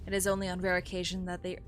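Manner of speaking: story narration. Acted. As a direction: read the game text evenly. Reading game dialogue.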